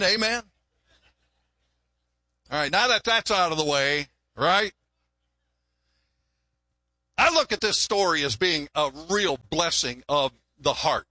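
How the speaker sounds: ordinary talk, nothing marked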